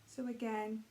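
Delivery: calm, even meditation voice with long pauses; guided meditation. Real, not acted. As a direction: ease them gently through each breath, unhurried. Leads a guided breathing meditation.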